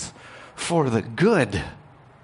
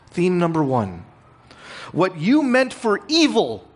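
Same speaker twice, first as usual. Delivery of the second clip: higher and louder, slow emphatic proclamation; full-force preaching; 7 semitones higher, 3 dB louder than usual. Acted. Pastor proclaims to his congregation, preaching at full force.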